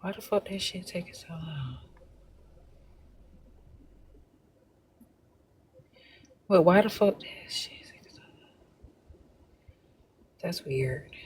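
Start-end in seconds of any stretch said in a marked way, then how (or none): 0.0-7.2 muttered aside. Real, not acted. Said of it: "muttering off-mic while checking something". 7.2-8.5 whispered irritated aside. Real, not acted. Host whispering to herself while checking something off-mic.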